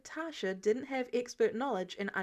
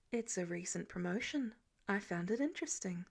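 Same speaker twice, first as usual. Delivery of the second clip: nonchalant; 4 semitones lower, 6 dB quieter than usual. A narrator's reading. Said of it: "she commented with a nonchalant shrug".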